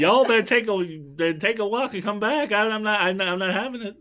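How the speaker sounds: high sassy Southern Belle voice; Southern Belle accent. Pretend. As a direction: warn them off like an angry Southern Belle. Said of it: "Like full on Southern Belle".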